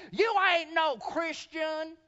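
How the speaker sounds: high, loud, slow accusing shout; imitating an old woman yelling in scorn; hammed-up old hag shouting at a man; mock angry shout. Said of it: "And she began to shout".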